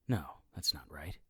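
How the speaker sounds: hushed self-correction; whispered aside. A narrator's reading. Narrator correcting his own thought, whispered. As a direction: whisper it to yourself.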